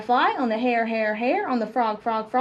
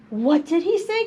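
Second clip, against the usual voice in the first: higher-pitched puzzled cry; raised, animated storybook exclamation; 5 semitones higher than usual. Acted. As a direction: cry out the question as baffled frogs. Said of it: "cried the frogs".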